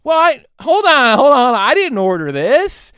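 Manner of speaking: mock confused diner. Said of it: "You're like".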